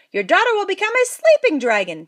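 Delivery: high, loud proclamation; old fairy's blessing. A narrator's reading. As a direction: announce it grandly. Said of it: "Tintinitis continued".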